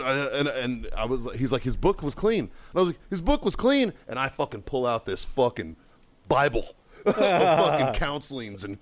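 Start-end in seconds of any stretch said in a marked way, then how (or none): 3.1-3.9 high-pitched mocking repeat; incredulous echo. Marine echoing first sergeant's excuse, incredulous. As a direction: throw the excuse back in disbelief.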